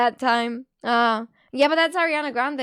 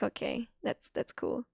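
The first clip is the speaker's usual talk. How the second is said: low, creaky and barely voiced; vocal fry; 6 semitones lower, 13 dB quieter than usual. Streamer mocking a mother's reply with vocal fry. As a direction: drag the words out in a lazy creak. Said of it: "Not the vocal fry".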